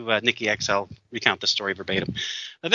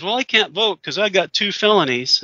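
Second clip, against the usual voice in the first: higher, blithe confession; drunk man voice; 9 semitones higher, 3 dB louder than usual. Retold or imitated. Drunk passenger admitting his felonies, higher voice. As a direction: admit it as no big deal.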